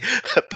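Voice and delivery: short high burst of laughter. Real, not acted. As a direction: let out a sudden high laugh.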